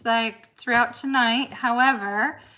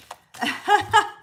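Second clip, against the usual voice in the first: a bright, high giggle; high burst of laughter; 8 semitones higher, 6 dB louder than usual. Real, not acted. Streamer laughing at her small audience, high giggle.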